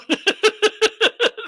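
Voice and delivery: loud, high burst of laughter. Real, not acted. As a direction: burst out in rapid high giggles. Laughs at question about Jackson's mic.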